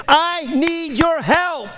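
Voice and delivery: high, drawn-out plea to God; impassioned high-pitched cry for help. Acted. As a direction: cry it out slowly from the pulpit. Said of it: "we scream it".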